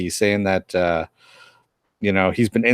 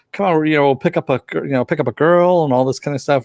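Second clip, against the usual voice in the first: coaxing Cassidy; 6 semitones higher, 3 dB louder than usual. Retold or imitated. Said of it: "is what, uh, Cass is saying".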